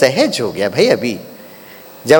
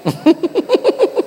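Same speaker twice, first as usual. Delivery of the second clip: high laugh; 11 semitones higher than usual. Real, not acted. Laughs at the punchline.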